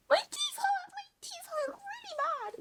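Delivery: very high, squeaky fake crying; mock whining. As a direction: whimper it in a tiny high voice, hammed-up. Retold complaint to dentist.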